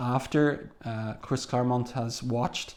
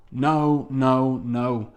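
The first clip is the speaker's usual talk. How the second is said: a touch raised, the first cries louder; mild retold yell; 4 dB louder, much slower than usual. Jubilee's cry on seeing Wolverine seemingly dead, mildly yelled. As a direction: read the yell half-heartedly, easing off by the end. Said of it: "she yells".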